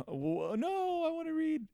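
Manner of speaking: high, excited protest; a reader who can't stop, high and eager. Retold or imitated. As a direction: squeal it like a hooked reader. Eager reader wanting more.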